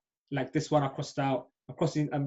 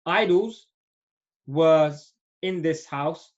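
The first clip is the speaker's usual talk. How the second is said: slowly, word by word, loud on idols; slow, careful reading; 3 dB louder, much slower than usual. Shown as a demonstration. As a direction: spell out the translation slowly for the students. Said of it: "Many idols was in this house".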